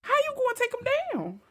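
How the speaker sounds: high-pitched playful outcry; mock incredulous challenge. Co-host doubts the duck-fighting plan, high mock incredulity. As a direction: challenge them in a high, disbelieving voice.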